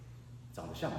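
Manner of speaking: low murmur. Retold or imitated. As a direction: mutter it tenderly to the wife under his breath. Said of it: "he goes".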